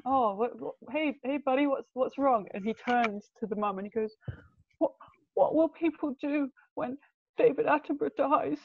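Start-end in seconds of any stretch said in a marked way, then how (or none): 0.0-2.5 put-on high-pitched coo to a little boy; concerned mum. Mum filming her crying little boy, imitated concern. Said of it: "the mum is kind of giggling". 4.8-8.6 high, small child voice, mock tearful; crying little boy. Little boy crying to his mum, imitated tearful voice. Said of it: "a little boy crying in the window".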